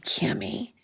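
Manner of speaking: breathy near-whisper of a name; low private scolding whisper; hushed Kimmy. Retold or imitated. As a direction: pull her aside and hiss her name softly. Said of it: "she'd say".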